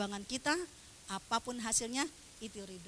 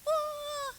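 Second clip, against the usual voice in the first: high-pitched mock wail; imitated bawling. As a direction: burst into a put-on cry. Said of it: "Wah, dia nangis, nangis-nangisnya".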